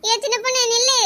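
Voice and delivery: calling after someone. Acted. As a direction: call her back as she runs off. Calling after girl running off crying.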